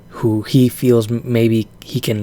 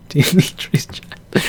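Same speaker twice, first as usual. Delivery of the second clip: whispering; 4 semitones higher than usual. Real, not acted. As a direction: giggly whisper. Laughing whisper of name.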